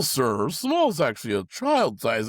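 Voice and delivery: drawn-out condescending drawl; slow smug clerk line. Retold or imitated. Clerk mimicked talking down to an old man, slowly. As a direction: talk down to the customer slowly. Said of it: "He's like".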